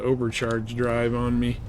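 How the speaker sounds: ordinary talk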